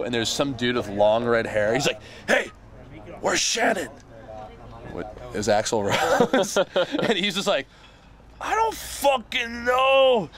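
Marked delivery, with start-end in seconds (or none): 2.3-3.9 mock rock star at the door. Retold late-night visitor at window. 8.4-10.3 high, slow, drawn-out mock yell; hammed-up angry uncle; annoyed mock shout. Uncle answering the visitor, retold.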